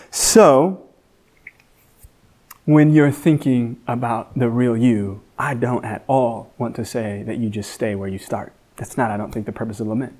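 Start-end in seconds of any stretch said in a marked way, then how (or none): none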